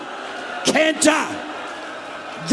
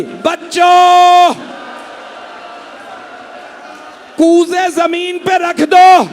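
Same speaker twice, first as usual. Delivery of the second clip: put-on high, loud, slow cry to the children; calling out; 5 semitones higher, 5 dB louder, slower than usual. A narrator's reading. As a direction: call out across the camp, drawn out and loud. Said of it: "आवाज दी". (बच्चों को ऊँची, धीमी पुकार)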